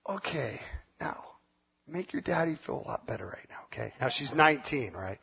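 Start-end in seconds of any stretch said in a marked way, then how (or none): 0.1-3.9 soft, fast coaxing. Retold or imitated. Father coaxing daughter to cheer him up, softly. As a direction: wheedle her gently to cheer up. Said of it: "I'm like".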